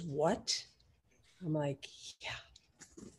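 hushed, low, almost whispered; stunned hush. Retold or imitated. Friends stunned by the thirty thousand dollar offer, hushed. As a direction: drop to a breath, as if stunned.

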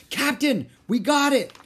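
high, loud pirate call; excited shout. A narrator's reading. Pirate crewman to his captain, high shouting. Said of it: "shouted Pinky".

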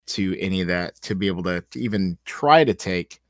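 ordinary talk

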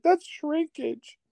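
high, slow squeak; mock tearful. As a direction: protest in an imitated weepy whine. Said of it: "all watered up".

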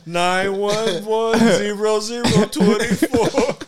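slow, loud, high number readout; slow agent readout. Pretend. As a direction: read it like a secret agent code.

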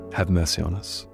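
usual speaking voice

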